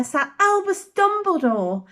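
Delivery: ordinary delivery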